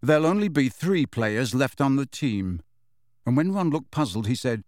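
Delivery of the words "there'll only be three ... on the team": usual speaking voice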